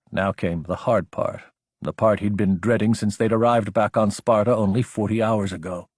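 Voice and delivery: ordinary delivery, nothing marked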